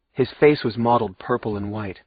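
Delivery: ordinary delivery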